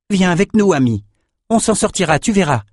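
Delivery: moved plea. A narrator's reading. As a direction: reach out to the old man with feeling. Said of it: "ému".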